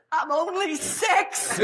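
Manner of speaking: high, drawn-out mock wail; crying little girl. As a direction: whine it slowly like a tearful child. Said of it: "this little girl starts crying".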